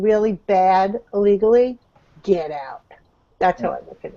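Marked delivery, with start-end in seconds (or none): 2.2-4.2 whisper. Real, not acted. Blunt verdict on illegals.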